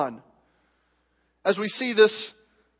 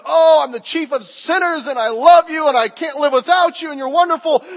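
higher, louder, effusive affection; gushing Paul; 6 semitones higher, 6 dB louder than usual. Retold or imitated. Paul to the church, gushing affection. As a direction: pour out love like an adoring brother. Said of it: "Then he says".

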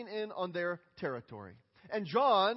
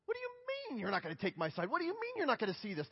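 soft, incredulous mock protest, swooping up in pitch; acted frustration; 4 semitones higher, 5 dB quieter than usual. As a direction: protest in hushed disbelief at being let down. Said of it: "took their frustration out on him".

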